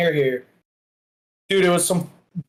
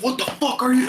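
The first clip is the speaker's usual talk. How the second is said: mock yell; 9 semitones higher than usual. Yelling at kid on ground.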